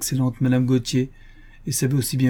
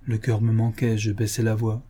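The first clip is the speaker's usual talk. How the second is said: ordinary delivery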